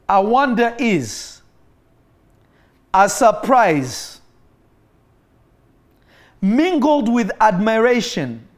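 slow, drawn-out dramatic reading; slow emphatic reading. Shown as a demonstration. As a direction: stretch each word out for effect. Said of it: "I will read it again".

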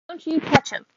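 high, sharp cry of outrage; fly at him defending her brother; a little girl's furious warning; fierce child protest. Acted. Scout defends Jem from man.

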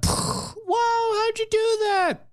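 high, wide-eyed mock amazement; amazed. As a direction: gasp it out like a wowed kid.

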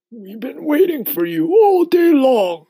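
put-on high, loud old grasshopper voice. A narrator's reading. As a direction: call out as a storybook old insect. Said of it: "the old green grasshopper said".

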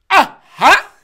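sudden high, loud barking laugh; mock loud laugh. Imitating KJ's laugh at the speaker's set, loud. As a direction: blurt a big fake laugh. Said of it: "and K J's like".

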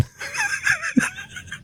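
shrieking laugh. Real, not acted. Burst of laughter.

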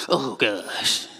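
low, eye-rolling dread; exasperated groan. Acted. Person seeing a complainer's caller ID, exasperated. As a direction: sigh it out, fed up with this caller. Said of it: "just rolled your eyes".

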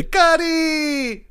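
high-pitched, drawn-out mock wail; Trevor Noah. Host mocking a comedian's catchphrase, high drawn-out wail. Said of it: "all he wanted to do was say".

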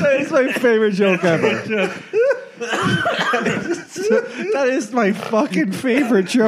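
high words squeezed out through laughter; helpless laughing. Real, not acted. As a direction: struggle to speak while cracking up. Listener cracking up after punchline.

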